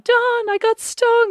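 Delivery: high, slow, whiny wail; mock sob to Don; childlike crying call for help; retold crying plea. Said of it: "And I was crying".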